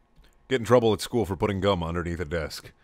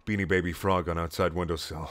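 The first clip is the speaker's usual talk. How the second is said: ordinary delivery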